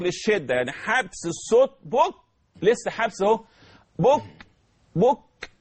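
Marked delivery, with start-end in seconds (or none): 1.8-5.5 بك held, then released with breath; stopped then breathy ك. Shown as a demonstration. Teacher demonstrates the whispered ك release. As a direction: stop the ك, then let breath out. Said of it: "أعمل جريان للنفس".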